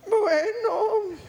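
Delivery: high, put-on reluctant reply; effeminate little voice. Preacher imitating the man agreeing to talk manly, high effeminate voice. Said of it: "Empiece a hablar como hombre".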